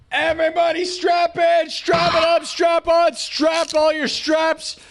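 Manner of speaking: high, slow, shouted hype chant. Acted. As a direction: pump the crowd up, way high. Hype intro before music.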